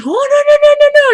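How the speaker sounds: very high, loud mock panic; rapid-fire squealed no's, mimicking her panic; hammed-up high-pitched freak-out; imitated freaking out. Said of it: "And she's freaking out".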